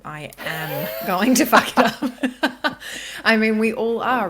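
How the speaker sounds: laughing through the words. Real, not acted. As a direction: let a laugh break the line. Laughs while admitting she'll fail.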